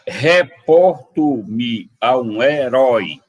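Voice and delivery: "Reporto-me" drawn out very slowly, syllables apart; scanning the verse. Shown as a demonstration. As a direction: mark every syllable of the line. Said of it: "Sete sílabas". (escandindo "Reporto-me a um herói" sílaba por sílaba)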